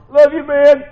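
high, loud bro voice from the ad; commercial guy impression. Preacher mimicking the TV ad line, high and loud. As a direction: toss it off like the beer-ad buddy. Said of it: "You ever see that commercial?".

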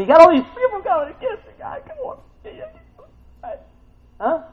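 high-pitched mock tearful plea; mock whining. Preacher teasing David's complaints, mock whiny sympathy.

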